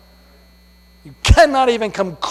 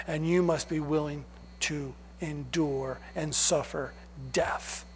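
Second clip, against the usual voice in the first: grave; 4 semitones lower, 9 dB quieter than usual. Retold or imitated. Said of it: "he is saying".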